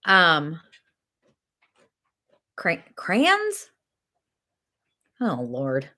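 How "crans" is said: "crayons" squashed to "crans", pitched up as a question; incredulous one-syllable "crayons", rhyming with cans; high questioning "crayons", said "crans"; mock mispronunciation. Mocking others' one-syllable crayons.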